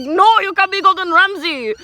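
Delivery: high, loud mock protest in an Indian accent; bad Indian accent. Boss refuses worker's chef act, in a loud mock Indian accent. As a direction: cry it out in a hammed-up Indian accent. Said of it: "horrible Indian accent".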